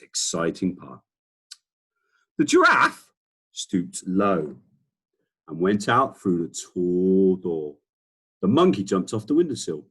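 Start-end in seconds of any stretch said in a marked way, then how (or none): 2.4-7.8 slow, clear storytelling voice; reading a picture book aloud; story narration. A narrator's reading. Teacher reading a picture book to the children, slow and clear.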